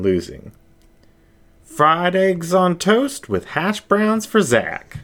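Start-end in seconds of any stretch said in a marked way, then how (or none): 1.6-5.0 high, slow diner call; a high, drawn-out call from the counter; server calling out. Acted. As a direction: sing out the order across the counter. Server calls out order.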